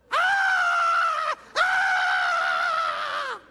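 high, loud scream of burning pain. Retold or imitated. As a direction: scream like the toe is on fire. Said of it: "I'm screaming the whole time".

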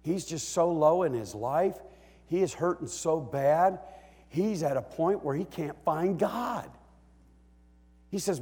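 normal talking voice

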